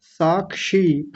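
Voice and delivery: साक्षी said very slowly with a clear ksh; साक्षी drawn out, क्ष crisp; slow repeat. Shown as a demonstration. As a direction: echo साक्षी slowly for the child. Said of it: "फिर से बोलिए".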